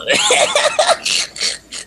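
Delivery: high burst of laughter. Real, not acted. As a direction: shriek with laughter. After hanging up on caller.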